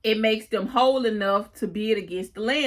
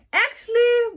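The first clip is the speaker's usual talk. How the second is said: "Actually" jumping much higher and louder; a smug retort that lands the point; sassy comeback; 14 semitones higher, 5 dB louder than usual. Retold or imitated. Charlie correcting Parker, high loud smug actually. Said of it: "And Charlie said".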